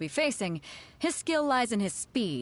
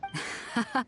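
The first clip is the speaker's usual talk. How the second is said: short laugh; 3 semitones higher, 3 dB quieter than usual. Real, not acted. Amused by the samurai's remark.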